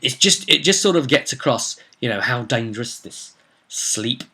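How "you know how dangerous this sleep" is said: usual speaking voice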